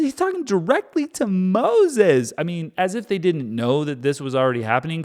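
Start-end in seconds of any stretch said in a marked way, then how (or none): 0.0-2.3 incredulous emphasis. Shown as a demonstration. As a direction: say it again, stunned it's real.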